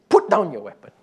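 firm command. Retold or imitated. Gladys orders armed man.

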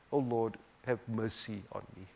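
hushed plea for mercy; humble soft prayer. Shown as a demonstration. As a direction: pray it quietly, bowed down.

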